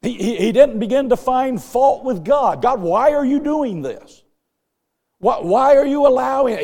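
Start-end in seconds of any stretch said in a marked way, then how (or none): 2.6-6.6 raised, higher pleading why to God; imagined accusing cry to God. Pretend. Imagined complaint against God.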